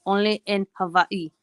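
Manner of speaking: Hawaii said "ha-VAI", as in the ad; commercial's Hawaii. Retold or imitated. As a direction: imitate the ad's local Hawaii. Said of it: "but they say it, like".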